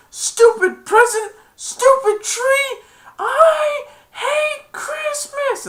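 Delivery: high, slow, sobbing yell; crying shout. Retold or imitated. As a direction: wail it out in a tearful rage. Grinch shouting in retelling.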